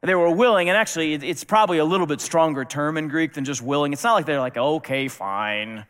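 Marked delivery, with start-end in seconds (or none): 4.6-5.8 grudging. Pretend. Grudging disciples voiced.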